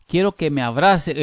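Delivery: cita de meme, bastante más fuerte; lánzalo como frase de meme, a todo volumen; cita de meme en voz alta. Retold or imitated. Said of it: "¿Cómo es que dice aquel meme?". (frase de meme dicha en voz alta)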